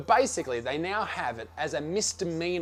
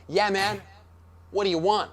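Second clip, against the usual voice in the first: put-on American accent, slightly raised pitch; 4 semitones higher than usual. Counter worker asks the customer's order, in an American accent. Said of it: "he didn't have an Australian accent".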